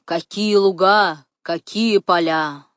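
slow, loud, drawn-out admiration; savoured, emphatic praise; admiring exclamation. A narrator's reading. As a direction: marvel at it, stretching the words. Игумен восхищается погодой и полями.

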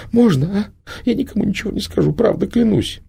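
put-on high, hurried girlish pleading; frightened girl. A narrator's reading. The girl swears to Prima she won't tell, high and hurried. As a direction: promise anything to get away.

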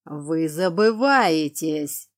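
slow, loud stern growl; stretched, forceful rebuke; growling reprimand. A narrator's reading. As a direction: drag the warning out menacingly. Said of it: "завибрировала от рыка".

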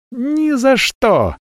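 very high, slow shriek. A narrator's reading. Talli refuses the dragon with a high, drawn-out shriek. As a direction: squeal the refusal in horror. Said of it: "взвизгнула".

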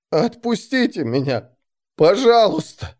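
high, drawn-out begging; whimpering entreaty, swelling on the last word; tearful plea. A narrator's reading. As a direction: plead on the edge of bawling. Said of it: "После чего очень натурально разревелся".

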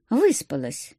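brief, low, falling reply; laconic. A narrator's reading. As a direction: answer tersely and close it off. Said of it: "лаконично ответила я". (лаконично)

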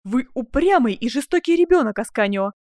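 loud, high, trembling-with-anger outburst; indignant, agitated cry; breaking with indignation. Acted. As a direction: hurl the reproach at him. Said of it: "произнесла она прерывистым голосом".

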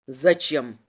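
low, curt question; low-pitched inspector; stern interrogator. A narrator's reading. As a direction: demand it in a low voice. Inspector presses for reason.

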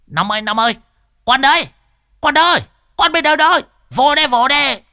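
very high, loud squawking; shrieking calls. Acted. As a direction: call out in a loud falsetto shriek. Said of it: "lại rú lên khanh khách".

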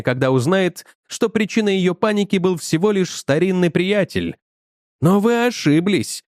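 ordinary delivery

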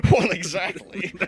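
slow, stuffed-nose pompous voice; mock pompous, blocked-nose delivery; pompous actor. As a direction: draw it out like a stuffy thespian. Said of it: "something stuck up your nose".

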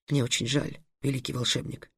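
soft, hushed condolence; very quiet. A narrator's reading. Karim privately consoling the great wizard, very quietly. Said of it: "Карим тихонько сказал Триксу".

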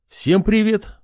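cheery greeting. A narrator's reading. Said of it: "улыбнулся он на камеру".

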